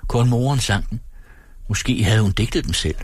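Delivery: plain reading voice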